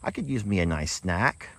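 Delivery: high, playful donkey voice; voicing Dan the donkey. Owner voicing her donkey's thoughts about snacks, high and playful. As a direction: speak as a greedy donkey thinking of snacks. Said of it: "Dan's like".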